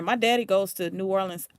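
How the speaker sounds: ordinary talk, nothing marked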